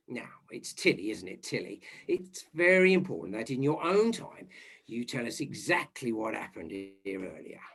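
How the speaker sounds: soft, low, careful questioning; hushed, reassuring police interview; gentle coaxing. Acted. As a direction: coax the shaken witness quietly. Inspector coaxing shaken maid.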